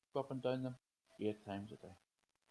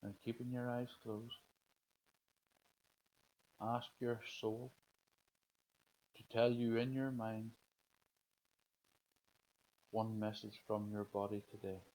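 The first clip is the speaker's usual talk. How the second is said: guided meditation. Acted. Leads a breathing meditation.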